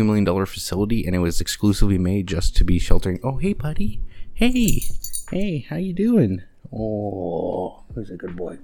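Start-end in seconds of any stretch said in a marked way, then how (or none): 3.2-6.4 high, sing-song voice for the puppy. Real, not acted. Owner greeting puppy Toast in high baby talk. Greeting the dog mid-recording. 6.7-8.6 1 soft pet talk. Real, not acted. Praising the dog softly.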